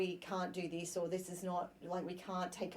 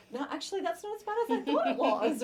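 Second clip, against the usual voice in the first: higher, louder pleasantly surprised voice; mock surprise; 11 semitones higher, 8 dB louder, faster than usual. Imagined eater tries the cake, higher pleasant surprise. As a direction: play someone who just found the cake is fine. Voicing someone after tasting cake.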